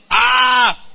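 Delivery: loud, high "ah" in retelling; acted exclamation. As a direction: cry out as the amazed professor. Acts out professor's reaction.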